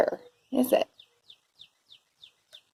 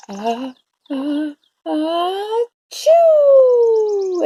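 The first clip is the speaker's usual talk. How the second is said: rising sneeze build-up bursting loud and high; acted sneeze; 9 semitones higher, 7 dB louder, much slower than usual. A narrator's reading. Bear sneezing from pepper, big storybook achoo. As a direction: hitch higher on each breath, then explode. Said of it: "and he blows and he sneezes".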